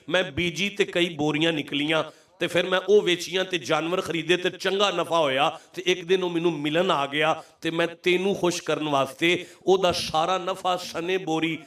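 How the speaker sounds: ordinary talk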